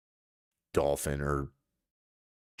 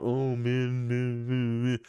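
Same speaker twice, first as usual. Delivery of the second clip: whiny complaining groan; mock whining; slower than usual. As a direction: moan it like a tired complaint. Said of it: "you've bitched your whole life".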